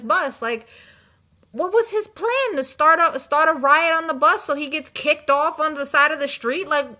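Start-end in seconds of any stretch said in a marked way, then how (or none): 1.5-6.9 incredulous. Real, not acted. Incredulous at the troublemaker.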